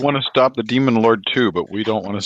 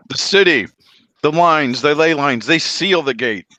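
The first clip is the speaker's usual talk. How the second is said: higher, louder urgent warning; 6 semitones higher, 4 dB louder than usual. Acted. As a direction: plead with rising alarm. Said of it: "And Opie says".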